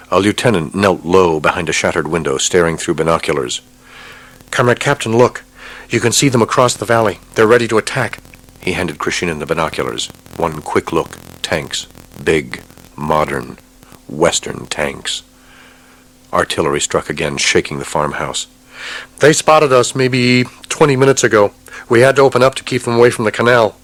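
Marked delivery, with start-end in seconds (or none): 4.5-8.2 lieutenant reporting. Acted. 19.2-23.8 higher, louder, fast report; hurried lieutenant. Acted. Lieutenant explains urgently.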